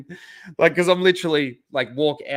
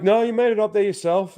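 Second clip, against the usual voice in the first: imitated concierge; 7 semitones higher, 3 dB louder than usual.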